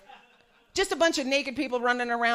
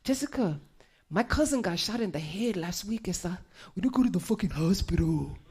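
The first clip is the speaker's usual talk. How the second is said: low, menacing gangster voice right in her face; gang member; 5 semitones lower than usual. Retold or imitated. Gang member scoffing close to her face, low and menacing. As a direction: drop the voice low and hard like a tough gang member. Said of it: "close to my face and he goes".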